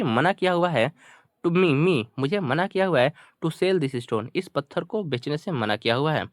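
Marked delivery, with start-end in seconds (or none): none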